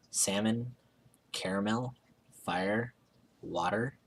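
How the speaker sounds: ordinary talk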